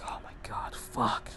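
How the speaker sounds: breathless whispered curse under the breath; shocked whisper. Retold or imitated. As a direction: whisper it in shock so she can't hear. Shocked reaction to crying phone call.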